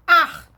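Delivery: ar alone, loud and punched; stressed syllable. Shown as a demonstration. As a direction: hit ar hard as a lone syllable. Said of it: "Sílaba tônica".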